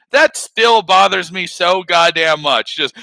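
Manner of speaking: usual speaking voice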